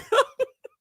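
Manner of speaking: high-pitched loud cackle; laugh. Real, not acted. Host cracking up over Chris's ankles, high cackle. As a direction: break into high, helpless laughter.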